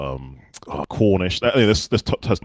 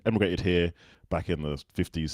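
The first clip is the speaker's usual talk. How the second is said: usual speaking voice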